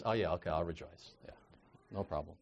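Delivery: low, quiet, half-hearted agreement; mock indifferent mumble; blah. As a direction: agree listlessly, with zero enthusiasm. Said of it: "sort of a blah kind of a way".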